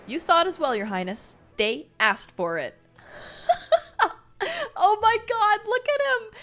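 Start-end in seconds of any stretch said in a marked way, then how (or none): 3.1-4.1 bright, high laugh that falls away; high burst of laughter. Real, not acted. Fan laughing at the comic, high and bright. 4.8-6.4 high, loud gush; excited squeal. Real, not acted. Fan gushing at the comic, high and loud. Fangirling over Windmaster.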